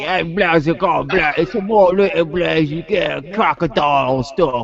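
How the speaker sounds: hammed-up Australian voice, slowing at the end; mock Aussie accent. Showing friends the Penguin's voice in a mock Aussie accent. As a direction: play the crocodile-hunter Aussie. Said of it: "He's an Aussie".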